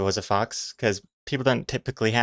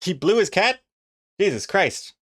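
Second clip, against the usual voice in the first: confused viewers; 8 semitones higher than usual. Retold or imitated. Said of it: "everyone's gonna be like".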